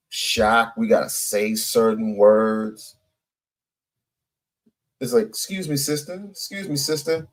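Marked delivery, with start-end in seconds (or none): 5.3-7.3 soft, overly polite excuse me; mock polite. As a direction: say it meekly and gently. Acted polite version of the confrontation.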